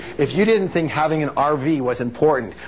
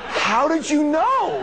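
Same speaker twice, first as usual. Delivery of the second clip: high, drawn-out astonished cry; hammed-up disbelief, pitched way up; gasping-in-awe act, stretched out; mock amazement; 12 semitones higher, slower than usual. Friend's amazed reply, acted.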